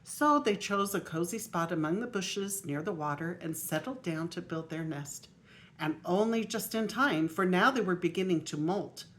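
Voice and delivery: lively storybook reading aloud; grandma reading a picture book aloud; reading to children. Acted. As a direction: read it out to the little grandchildren.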